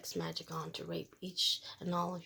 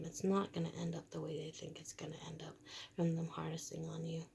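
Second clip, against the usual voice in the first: secretive whisper; 7 dB quieter than usual. Real, not acted. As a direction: whisper it like a warning. Warning about what's coming.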